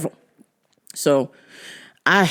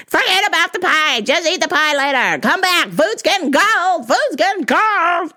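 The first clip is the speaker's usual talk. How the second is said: higher, nagging mom on the phone, repeating herself; impatient mom; 9 semitones higher than usual. Retold or imitated. Mom calling her son back, high and nagging. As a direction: whine it like a mom whose dinner is going cold.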